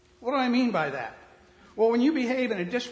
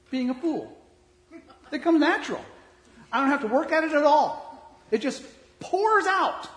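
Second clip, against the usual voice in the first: self-mocking laugh; 7 semitones higher, 6 dB louder than usual. Real, not acted. Preacher self-mocking brag.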